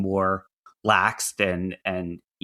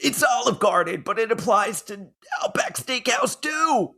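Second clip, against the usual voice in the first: laughing; 12 semitones higher than usual. Retold or imitated. Continuing ad parody laughing.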